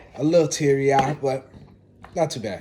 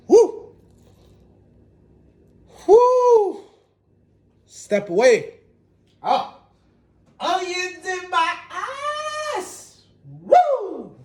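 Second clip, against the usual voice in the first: high falsetto whoops and playful wailing; hammed-up agony over stinging eyes; half-sung, very high mock distress; overdramatic; much slower than usual. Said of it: "Man, a little dramatic".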